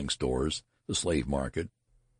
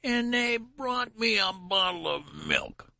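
put-on very high, slow shrill old-man wail; outraged squeal of complaint; shrill protest; slower than usual. A narrator's reading. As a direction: stretch the protest out at the top of the voice. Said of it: "rose to a shrill rise of protest".

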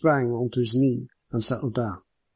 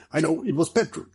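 higher-pitched cry of realisation; excited cry; 4 semitones higher than usual. Acted. Ricardo guessing what the detective smelt, mildly excited. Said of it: "cried Ricardo".

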